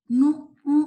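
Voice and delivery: "نو اون" loud with a nasal "اون"; wrong oon sound. Shown as a demonstration. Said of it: "اون کی sound نہیں آئے گی". (غلط "اون" کی آواز)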